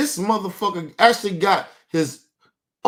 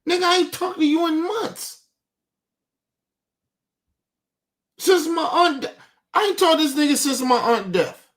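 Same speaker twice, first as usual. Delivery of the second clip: heated outrage; 9 semitones higher than usual. Real, not acted. To his dad, who hasn't called in months, heated outrage. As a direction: let the disbelief boil over. Venting about his dad's accusations.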